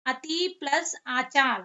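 teacher dictating each piece slowly; slow split. Shown as a demonstration. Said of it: "क्या हुआ हमारा".

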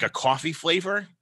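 ordinary talk

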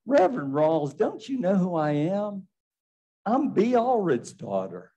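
lightly voiced Southern accent. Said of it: "that nice Southern accent".

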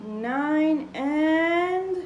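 put-on slow, drawn-out counting for children; teaching a child to count. Shown as a demonstration. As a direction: count each number slowly. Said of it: "I'm gonna make ten dots".

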